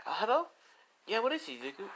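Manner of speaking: put-on very high girlish phone greeting; high, questioning pickup; Lisa. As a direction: answer the call in a high-pitched girl's voice. Lisa answers boyfriend's phone call.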